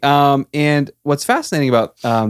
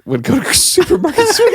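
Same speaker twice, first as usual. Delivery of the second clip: laughing; 3 dB louder than usual. Real, not acted. Laughing while teasing a friend.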